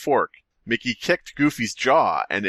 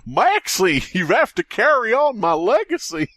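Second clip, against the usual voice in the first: high dramatic dying Goofy; overacted death scene; 11 semitones higher, 3 dB louder than usual. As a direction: ham up dying last words. Goofy's dying words acted.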